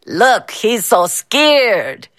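slow sneering taunt; drawn-out mocking jeer. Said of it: "Mister Bao taunted".

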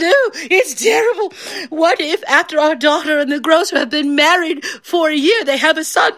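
high, tearful wife's wail; hammed-up sobbing distress; weeping and wailing. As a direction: wail the imagined tragedy to your husband. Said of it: "he hears all this weeping and wailing".

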